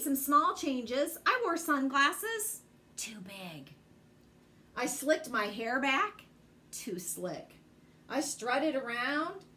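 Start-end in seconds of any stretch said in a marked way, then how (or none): 3.0-3.7 much lower and softer, muttered under the breath; a quiet deflating aside; dropped, hushed verdict. Acted. Side comment on sunglasses. 6.7-7.5 much lower and softer, muttered under the breath; a quiet deflating aside; dropped, hushed verdict. Acted. Side comment on slicked hair.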